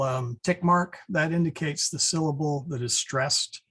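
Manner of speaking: ordinary delivery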